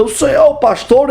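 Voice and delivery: shouted question. Retold or imitated. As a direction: call out to the pastor from afar.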